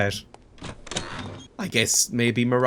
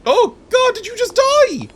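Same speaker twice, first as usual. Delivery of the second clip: high, loud startled yelp; startled shock; 7 dB louder than usual. Real, not acted. Player startled at someone dropping dead, high shocked cry. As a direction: cry out in sudden high-pitched alarm.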